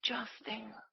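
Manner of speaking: breathless tense whisper. Real, not acted. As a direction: barely breathe the words. Tense moment at the target.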